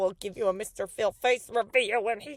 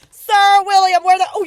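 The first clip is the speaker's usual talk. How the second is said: loud yelling; 7 semitones higher, 13 dB louder than usual. Real, not acted. Keeps yelling.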